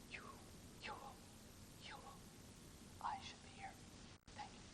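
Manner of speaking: a hushed whispered line to test their focus. Shown as a demonstration. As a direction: whisper it to the room. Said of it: "demo whispered".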